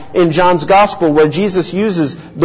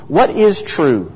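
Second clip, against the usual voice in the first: ordinary talk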